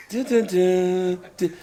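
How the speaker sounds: slow hummed dun dun dun; slow dramatic sting hummed; mock dramatic music. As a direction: sing the dramatic score slowly. Imitating the dramatic film music.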